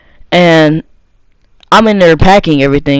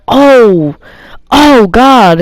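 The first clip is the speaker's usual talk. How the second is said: dread; 5 semitones higher, much slower than usual. Retold or imitated. Dreading the mother's yelling upstairs.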